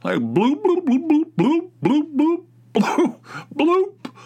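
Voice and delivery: high, loud repeated bloop noises mimicking the toilet; rapid fire bloops. Imitating the toilet blooping to listeners, high loud bloops. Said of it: "rapid fire blooping".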